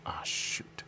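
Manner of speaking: unvoiced whisper, muttered to self; whispered curse. Real, not acted. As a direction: breathe it out, annoyed. Frustrated under breath.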